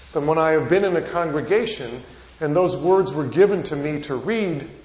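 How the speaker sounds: usual speaking voice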